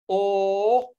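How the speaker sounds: long held "O" as in door; vowel demonstration. Shown as a demonstration. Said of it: "as in door".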